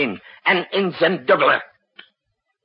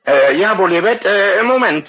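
raised, louder voice, breaking off the call; 4 semitones higher, 8 dB louder than usual. Acted. President loudly holding the general on the line, calling out. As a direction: call out over the phone mix-up.

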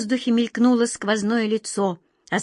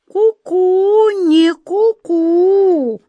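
high, loud, long-drawn cuckoo riddle; mysterious cuckoo; 10 semitones higher, 8 dB louder, much slower than usual. Acted. Cuckoo answering about the key, mysteriously. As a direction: stretch the final call enigmatically. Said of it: "последовал тот же загадочный ответ".

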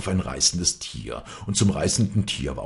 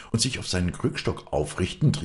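plain reading voice

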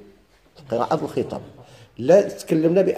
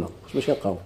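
ordinary delivery

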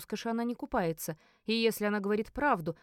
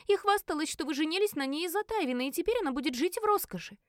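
голос девицы; 8 semitones higher than usual. A narrator's reading. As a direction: pipe up to tell on someone. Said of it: "добавила одна из девиц". (высоким голоском девицы-ябеды)

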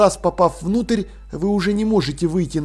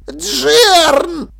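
high, loud, stretched-out cry; alarmed call; 10 semitones higher, 7 dB louder than usual. A narrator's reading. Dil calls his apprentice, alarmed. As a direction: yelp the name in alarm. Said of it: "окликнул Диль, вытаращив глаза".

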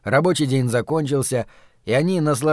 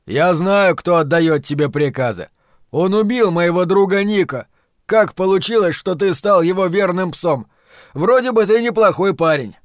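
louder, higher-pitched indignation; angry accusation; 4 semitones higher, 4 dB louder than usual. A narrator's reading. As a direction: confront a man who serves your friend's killer. Ralph angrily confronts Dorgan over killing. (гневно, с обвинением)

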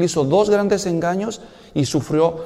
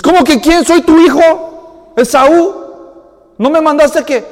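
high, loud, puzzled son; confused Esaú; 10 semitones higher, 9 dB louder than usual. Retold or imitated. Son answering his startled father, high and puzzled. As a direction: protest that it is really you.

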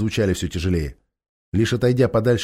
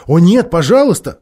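loud, higher cry of entreaty; pleading; 5 semitones higher, 7 dB louder than usual. A narrator's reading. Джил begging the king to spare the donkey, pleading. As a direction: beg urgently, voice raised. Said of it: "взмолилась Джил".

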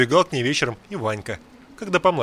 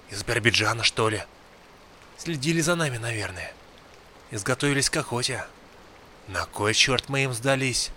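put-on gruff old man, tense; 2 semitones lower, 3 dB quieter than usual. A narrator's reading. Old man's tense warning. (старик, тихо и напряжённо)

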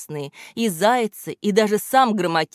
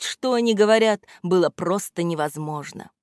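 usual speaking voice

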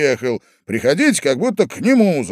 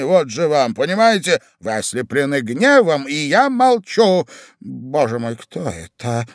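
ordinary delivery, nothing marked

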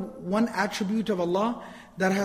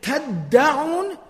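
تَدَّعُونَ with the middle d held hard; emphatic doubled consonant; 6 semitones higher, 5 dB louder, much faster than usual. Shown as a demonstration. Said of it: "is with more emphasis".

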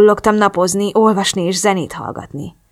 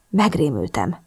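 ordinary delivery, nothing marked

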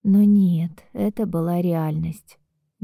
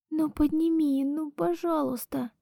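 soft, high desperate begging; pleading; 8 semitones higher, 7 dB quieter than usual. Acted. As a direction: beg him quietly through tears. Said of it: "умоляла в трубку".

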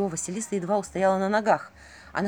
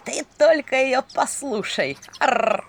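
hoarse mocking raven; 5 semitones higher, 4 dB louder, slower than usual. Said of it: "хрипло рассмеялся Ворон".

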